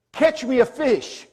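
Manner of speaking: high, loud, slow command; firm command. Retold or imitated. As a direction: order it with weight. Jesus's command, retold.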